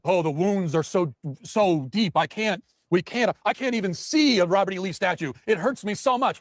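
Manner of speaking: slow, high, hammed-up whimper of the offended; mock the oversensitive crowd, whining; mock wounded whiner. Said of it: "Oh, shut the f-, up".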